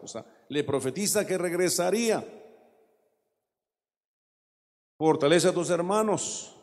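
loud sermon voice for the words of Jesus; fervent, loud preaching of the scripture; preaching at full force. Acted. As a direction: proclaim it to the whole church.

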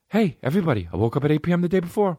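lightly voiced celebrity wake-up brag; a hint of a hyped fitness star; Mark Wahlberg impression. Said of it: "Hey, Mark Wahlberg".

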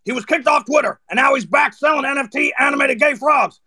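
an over-the-top Alex Jones rant. Pretend. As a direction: rant like an InfoWars host. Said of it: "see what that one's all about".

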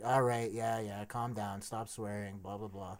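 low, trailing-off brush-off; soft, low mock brush-off; dismissive call-taker. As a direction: imitate a dismissive operator, voice dropping away. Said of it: "her attitude flips as soon as she".

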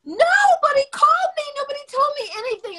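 high, loud near-crying cry of shock; overwhelmed disbelieving shout; shocked outcry. Retold or imitated. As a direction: let it burst out half in tears. Shocked nobody told her.

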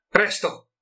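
sharp urgent shout, pitched high. A narrator's reading. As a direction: yell the order to hurry across the yard. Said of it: "urlò a Haynes".

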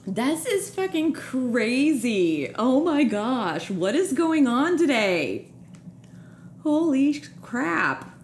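slow, high, drawn-out amazement; stunned disbelief. Real, not acted. Host taking in guest's revelation, stunned disbelief. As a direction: draw each exclamation out in real astonishment.

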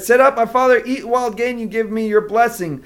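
proud son presenting the meal, bright call; eager son. Acted. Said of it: "and then he said".

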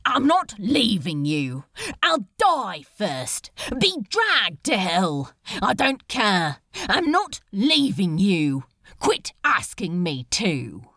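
loud, desperate insistence; defiant refusal. Acted. Crowley refusing to abandon the angel, loud and desperate.

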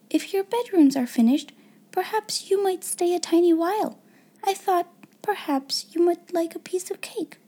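put-on high, coaxing young-girl voice; kindly little girl. A narrator's reading. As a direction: invite her gently, child to child. Sarah invites Becky to stay.

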